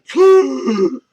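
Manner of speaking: a loud, sharp mock gasp of a whole room; shocked group gasp. A comic imitates the whole room reacting, loud sharp gasp. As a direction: suck the air in like a stunned crowd. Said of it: "the whole room went".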